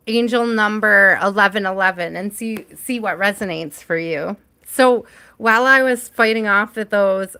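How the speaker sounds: ordinary delivery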